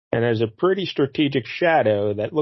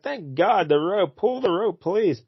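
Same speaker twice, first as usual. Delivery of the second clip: higher, pleading for the rope; mock pleading heroine; 6 semitones higher, slower than usual. Heroine in the well begging the rescuer, pleading. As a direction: beg him to pull the rope.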